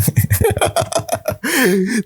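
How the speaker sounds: high breathy laugh. Real, not acted. Laughs at his own cheesy line.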